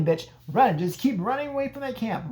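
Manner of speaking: ordinary talk, nothing marked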